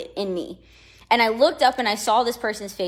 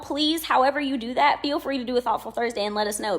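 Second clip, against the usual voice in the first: ordinary talk, nothing marked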